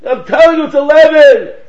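loud, high, insistent hollering; heated arguing shouts. Retold or imitated. Two guys arguing over a number, shouting. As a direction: imitate two stubborn men yelling back and forth. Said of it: "he heard two guys arguing".

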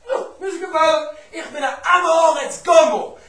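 loud, high acted protest; emphatic protest. Student explaining himself to the wagon driver, loud emphatic protest. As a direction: proclaim it forcefully, slowing on the admission.

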